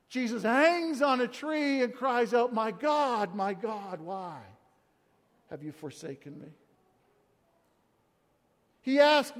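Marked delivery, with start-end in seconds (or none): 2.5-6.5 starts plain and sinks to a near-silent, low hush; fading to a hush. Acted. Preacher letting Jesus' cry fade out, falling to a hush. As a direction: let the cry die away into nothing.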